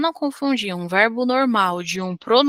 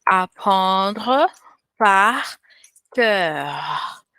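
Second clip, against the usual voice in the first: French phrase; much slower than usual. Shown as a demonstration. Teacher quoting the French idiom, said with a French accent. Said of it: "como diziam os franceses".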